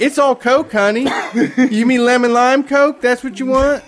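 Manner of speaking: mock Southern waitress.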